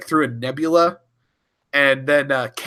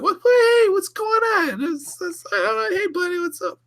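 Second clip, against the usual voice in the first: very high, fake-friendly squeak; mock innocent Sulu. Host replaying Sulu's fake-friendly greeting to Kang, very high. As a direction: play dumb in a high squeaky voice. Sulu voiced again, retelling.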